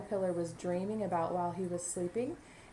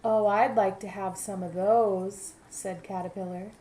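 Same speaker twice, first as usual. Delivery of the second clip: lively picture-book narration; storybook voice for young listeners; reading to children; 2 semitones higher, 5 dB louder than usual. A narrator's reading. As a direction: read it aloud to a class of kids. Teacher reads story to class.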